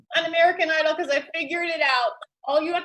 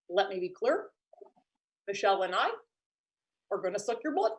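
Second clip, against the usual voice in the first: Obama as a vampire; 9 semitones lower, 9 dB quieter, faster than usual. Pretend. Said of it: "Barack Obama, if he was a vampire".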